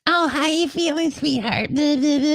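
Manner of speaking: high, sweet motherly falsetto; mom's voice. Retold or imitated. Mom asks her sick son how he feels, high sweet imitation. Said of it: "She goes".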